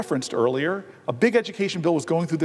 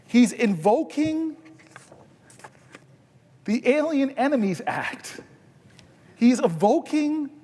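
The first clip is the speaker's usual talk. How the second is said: higher and slower, rising into righteous indignation; building indignant oratory; 6 semitones higher, slower than usual. Acted. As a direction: preach it, slow and lifting, like a floor speech at full force. Rising floor-speech indignation.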